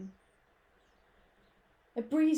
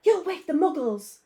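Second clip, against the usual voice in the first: urgent scolding; 6 semitones higher, 9 dB louder than usual. A narrator's reading. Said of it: "hissed M-, Professor McGonagall".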